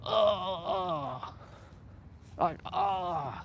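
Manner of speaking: high, whiny mock-crying ughs; frustrated groans. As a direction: groan like you're about to cry from frustration. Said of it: "I'm like".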